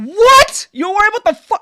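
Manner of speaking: loud, high-pitched incredulous outburst; outraged disbelief. Real, not acted. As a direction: burst out in real disbelief. Outraged reaction to the story.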